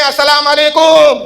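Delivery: high, slowly drawn-out salaam; mocking showy salaam. Preacher mocking a cleric's salaam at the back rows, high and slow. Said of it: "پیچھے والے نہیں بول رہے ہیں".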